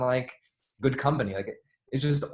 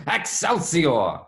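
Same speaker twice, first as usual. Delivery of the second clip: slow, drawn-out, higher and louder cry; Stan Lee's Excelsior; 6 semitones higher, 6 dB louder, much slower than usual. Retold or imitated. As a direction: belt it out to the stadium crowd. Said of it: "and he goes".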